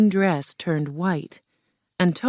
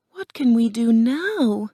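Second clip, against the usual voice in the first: put-on higher, mildly dismayed girl's voice; sad question; 6 semitones higher, 4 dB louder than usual. A narrator's reading. Dorothy asks her friends what's next, mildly sad. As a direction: ask with a touch of despair. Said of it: "Dorothy asked sadly".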